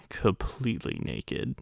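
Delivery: "completely naked" dropped to a soft slow near-whisper; hushed aside. Retold or imitated. Repeats the word completely.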